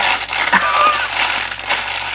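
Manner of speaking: very high, breathless laugh of glee; squealing laugh. Real, not acted. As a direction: squeal with delight. Thrilled by friend's gift.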